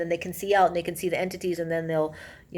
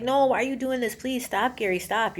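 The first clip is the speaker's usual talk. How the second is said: higher-pitched mock plea, no real scream; retold screaming plea; 6 semitones higher than usual. Midwife begging Gary to stop, mild retold scream. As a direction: imitate begging someone to stop, understated. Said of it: "and she's screaming".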